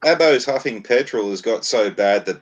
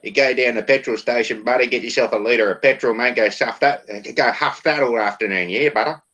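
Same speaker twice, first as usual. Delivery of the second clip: lightly put-on outback Australian accent, a bit higher; mock outback Australian; 4 semitones higher than usual. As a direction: play a rough outback Australian bloke egging a mate on, Australian twang. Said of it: "that's how they speak".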